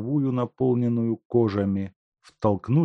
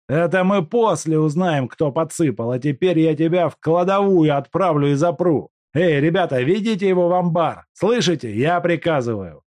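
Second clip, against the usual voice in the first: angry command; 9 semitones higher, 6 dB louder than usual. Acted. Куликов приказывает увести.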